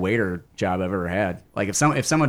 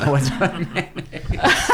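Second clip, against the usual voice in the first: laughing; 6 semitones higher, slower than usual. Real, not acted. Laughing at the joke.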